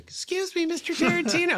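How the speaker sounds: tiny, very high mock-timid voice; starstruck fan squeak. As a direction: squeak politely for an idol's attention. Retelling his timid fan approach.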